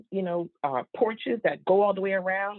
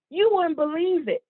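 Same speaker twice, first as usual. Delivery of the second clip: usual speaking voice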